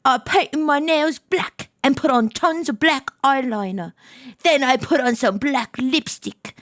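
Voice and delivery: amateur British narration; British accent. Acted. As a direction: keep up the put-on British accent. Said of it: "put my British accent back on".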